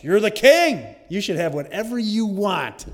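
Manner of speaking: hammed-up indignant queen; Jezebel. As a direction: egg on a sulking husband, emphatically. Acting Jezebel urging on the king.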